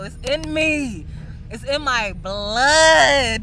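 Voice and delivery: loud, slow, swelling declaration; booming, drawn-out pride; passionate proclamation. Real, not acted. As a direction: proclaim it from the heart. Proud climax of his speech.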